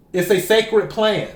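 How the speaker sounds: ordinary talk, nothing marked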